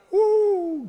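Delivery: very high, loud, drawn-out "woo"; praise whoop. Real, not acted. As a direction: whoop in church exultation. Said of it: "feel like a shout coming on".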